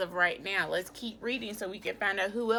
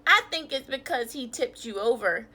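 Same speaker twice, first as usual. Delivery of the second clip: usual speaking voice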